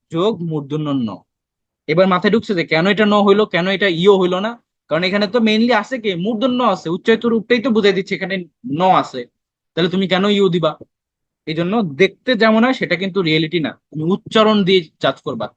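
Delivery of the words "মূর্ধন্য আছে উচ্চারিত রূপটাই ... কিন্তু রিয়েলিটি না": ordinary delivery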